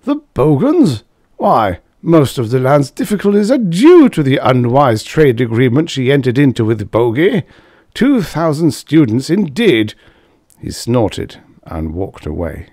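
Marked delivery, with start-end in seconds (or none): 0.0-9.9 a raised, higher huffy official voice; indignant official. A narrator's reading. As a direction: scoff at the whole idea. Character voice in read story.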